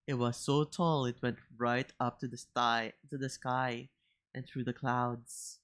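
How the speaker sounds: put-on gentle children's book narration; story narration. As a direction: read it like a bedtime story. Reads the children's story aloud.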